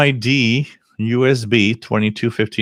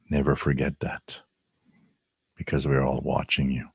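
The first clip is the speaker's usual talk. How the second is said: mock-ominous ghost story voice, hushed; big spooky voice; 5 semitones lower, 5 dB quieter than usual. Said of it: "I got the big voice for it".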